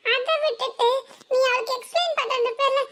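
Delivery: high-pitched, half-laughing scolding of a sister; scolding elder sister. Acted.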